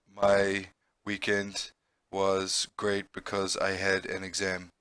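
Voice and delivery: slow, flat and quiet with no change of tone; imitating a student's flat monotone sarcasm; flat deadpan. Shown as a demonstration. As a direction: say it totally deadpan so the joke is missed. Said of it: "'cause they always talk like this".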